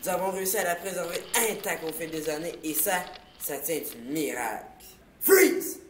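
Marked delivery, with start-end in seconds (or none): 5.2-5.8 shouted freeze. Acted. Sudden call to stop.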